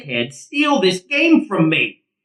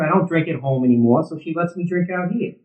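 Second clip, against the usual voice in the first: usual speaking voice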